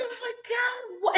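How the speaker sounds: high, hushed disbelief; overwhelmed gasp. Real, not acted. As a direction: breathe it out, overcome. Overwhelmed by the scene.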